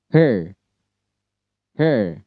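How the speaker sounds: hair with a hooked R sound; American hair, R strong. Shown as a demonstration. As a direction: say hair ending in a clear R. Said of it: "Cara bacanya itu er".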